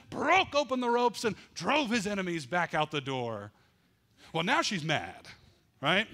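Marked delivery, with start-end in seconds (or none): none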